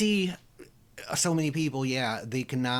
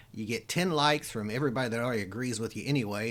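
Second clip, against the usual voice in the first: ordinary delivery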